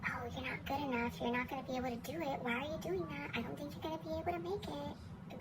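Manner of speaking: hushed, sneering inner critic. Retold or imitated. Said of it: "that little negative voice in my head".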